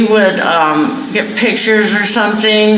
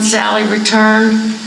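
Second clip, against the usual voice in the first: usual speaking voice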